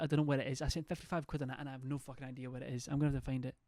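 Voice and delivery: ordinary talk, nothing marked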